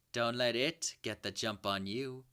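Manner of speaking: usual speaking voice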